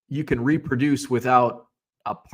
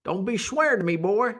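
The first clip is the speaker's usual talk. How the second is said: higher-pitched mock Southern scolding; Southern accent; 5 semitones higher than usual. Testing which word sounds Southern, mock Southern accent. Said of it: "If you use a Southern accent".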